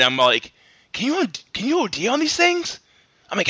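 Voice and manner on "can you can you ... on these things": mock worried question. Retold worry about energy drinks.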